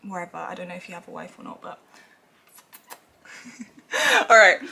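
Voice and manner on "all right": laughing through the words, louder; real laughter. Real, not acted. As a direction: giggle through it at yourself. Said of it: "so I'm rambling on".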